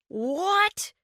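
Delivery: high startled roar. A narrator's reading. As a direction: bellow it in disbelief. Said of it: "Gary roared".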